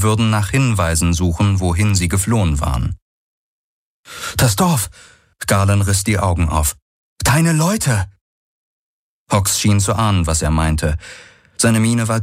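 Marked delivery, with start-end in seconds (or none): 4.4-4.9 sudden higher-pitched alarm. Acted. Sudden alarm about the village. 7.2-8.1 high, urgent exclamation; dawning alarm, pitched high. Acted. Alarm about companion's people.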